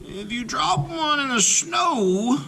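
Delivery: high, cautioning granny voice; a grandmother's high warning. A narrator's reading. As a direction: warn him like a fond grandmother. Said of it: "she warned".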